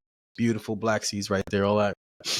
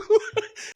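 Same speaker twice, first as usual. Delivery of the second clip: high laugh. Real, not acted. Laughing at own story.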